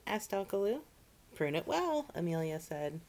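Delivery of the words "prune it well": chirpy instruction. Acted. Amelia instructs.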